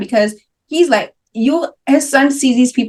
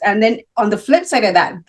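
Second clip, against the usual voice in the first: ordinary delivery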